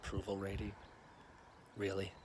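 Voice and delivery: quiet disbelief. Acted. Incredulous mocking repetition.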